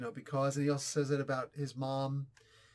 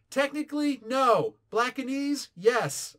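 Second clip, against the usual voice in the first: higher, louder mock of the wife's playful reply; imitating wife; 9 semitones higher, 7 dB louder, slower than usual. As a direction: lightly voice the wife, teasing and bright. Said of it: "She's like".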